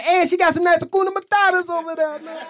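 high, loud Italian-style catcall; mock Italian catcaller. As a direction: holler it as a hammed-up Italian compliment. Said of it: "like a phrase Italians use to compliment women".

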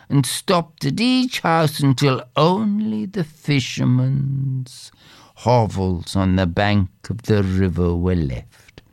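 ordinary delivery

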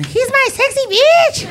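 very high, drawn-out squeal; exaggerated high-pitched wifely gushing; wife's doting squeal. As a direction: imitate a wife in a high, slow falsetto. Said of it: "His wife calls him Sexy".

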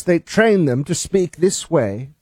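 put-on British accent. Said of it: "presenters have to talk like this".

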